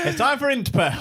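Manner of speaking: high hammed-up announcer; laughing show host intro. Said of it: "Ladies and gentlemen".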